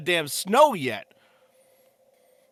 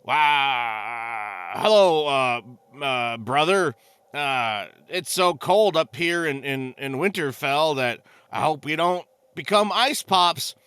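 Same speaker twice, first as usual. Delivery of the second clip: Jaime Lannister; 6 semitones lower, slower than usual. Retold or imitated. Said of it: "Jaime Lannister would come up".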